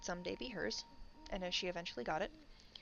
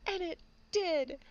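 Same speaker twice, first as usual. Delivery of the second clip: mock crying; 7 semitones higher, 5 dB louder than usual. Man mourning his vanished hair to his family, hammed-up sobbing. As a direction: wail it like a hammed-up heartbroken man. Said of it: "who's just like".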